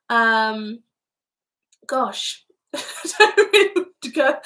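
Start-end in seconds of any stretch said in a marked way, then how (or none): 2.7-4.4 high, loud words squeezed through laughing; real giggly fluster; speechless with laughter. Real, not acted. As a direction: laugh helplessly as you lose your thread. Thrown by comment, laughing.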